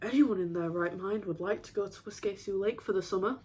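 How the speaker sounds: ordinary talk, nothing marked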